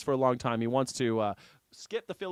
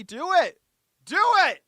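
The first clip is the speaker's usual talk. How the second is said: high, loud rallying yell; cheering shout; 9 dB louder than usual. Real, not acted. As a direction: cheer them on at full voice.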